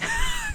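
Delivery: a short squeaky burst of laughter; laughing at the recap; sudden high laugh. Real, not acted. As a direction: let a high giggle escape. Laughing at T'Challa's line.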